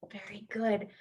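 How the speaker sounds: a soft whispered Very good; whispered praise. Real, not acted. Teacher whispering praise to the kids after the chant. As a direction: hush it like a secret compliment.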